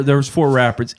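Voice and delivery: ordinary talk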